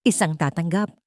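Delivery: usual speaking voice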